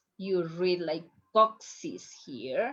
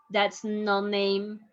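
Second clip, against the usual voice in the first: ordinary talk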